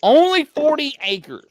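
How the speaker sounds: high, loud mock disbelief; loud, high-pitched sarcastic echo. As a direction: throw it back high and loud, incredulous. Mocking echo of friend's modesty.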